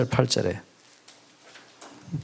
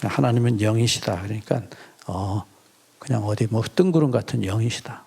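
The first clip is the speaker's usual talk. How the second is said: a hint of a dull misreading, pitched lower; mock wrong reading; 6 semitones lower than usual. Mocking how some read the verse, lower-pitched misreading. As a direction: lightly voice the reader who gets it wrong. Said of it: "그렇게 읽으시면 안 된다니까요".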